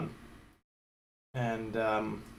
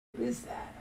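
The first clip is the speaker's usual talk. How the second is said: whispered; 9 dB quieter than usual. Real, not acted. Whispered repeat.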